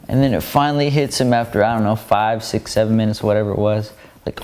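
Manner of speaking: ordinary talk, nothing marked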